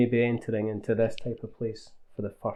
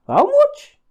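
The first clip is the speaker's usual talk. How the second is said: squeaky, much higher "How much?" in mock disbelief; mock outrage at the cost, very high and louder; shocked; 8 dB louder than usual. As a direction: yelp the price question in fake shock. Shocked at price in skit.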